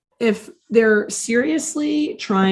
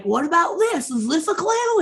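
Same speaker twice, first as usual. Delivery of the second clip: high, badly faked Australian accent; Monty's accent; 8 semitones higher than usual. Monty teasing Chip with a find, mock Australian accent. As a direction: attempt a goofy Australian accent, pitched way up. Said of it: "I wish I could do Monty's accent".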